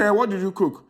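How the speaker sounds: high, loud, disbelieving demand; exasperated shout. Acted. Man shouting at his Gen Z date over the cooking slang, exasperated. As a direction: shout the question, out of patience.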